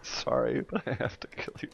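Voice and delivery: hushed apologetic killer, played for laughs; quiet mock apology. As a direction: threaten softly, as if embarrassed. Acting apologetic Canadian assassin.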